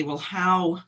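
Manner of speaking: ordinary delivery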